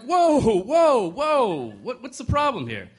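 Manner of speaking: high, loud, startled protest. Retold or imitated. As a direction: back off, alarmed and startled. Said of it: "The guy's like".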